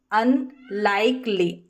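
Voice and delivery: अनलाइकली said slowly, split as अन-लाइक-ली; slow syllable demo. Shown as a demonstration. Teacher showing how to divide अनलाइकली, slow and deliberate. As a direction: break अनलाइकली into its parts, very slowly. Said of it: "इस तरीके से हम इसको divide".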